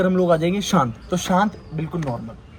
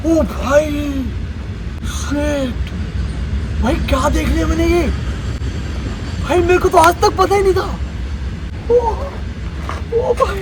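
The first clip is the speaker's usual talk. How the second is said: much higher, amazed exclamations; acted wonder; 12 semitones higher, 4 dB louder than usual. Demonstrating the wonder emotion to viewers, high awed exclaims. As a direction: gasp in awe at a marvel. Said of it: "अभी आता है guys अद्भुत".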